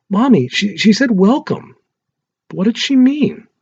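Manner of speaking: put-on high, small child's voice, scared and confused; a frightened kid waking mommy in the night; little boy voice. Small boy telling mommy about a night visitor, high and scared.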